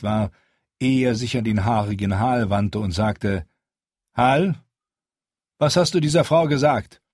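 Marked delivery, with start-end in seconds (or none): 4.1-7.0 Jon questions Hal. Acted.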